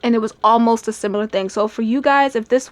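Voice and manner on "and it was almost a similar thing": normal talking voice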